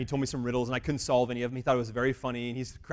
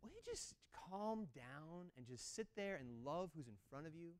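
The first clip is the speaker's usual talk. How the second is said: gentle rebuke; 4 semitones higher, 18 dB quieter than usual. Retold or imitated. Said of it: "the Lord is saying".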